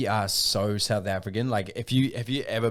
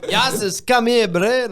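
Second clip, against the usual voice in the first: mocking a clumsy South African accent, pitched up; bad South African accent; 10 semitones higher, 4 dB louder, slower than usual. Friend mocking DiCaprio's South African accent. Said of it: "it sounded horrible".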